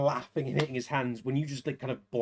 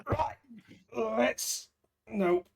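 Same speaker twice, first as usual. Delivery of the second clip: put-on drunk fighter fading out; 9 semitones higher, much slower than usual. GM voices drunk fighter collapsing.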